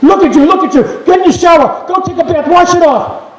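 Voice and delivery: high, loud hurried orders; mother barking at her son to wash up; scolding mom. Retold or imitated. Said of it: "drags Larry to the mirror and says".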